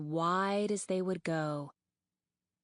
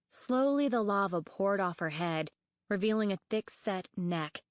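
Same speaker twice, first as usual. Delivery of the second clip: plain reading voice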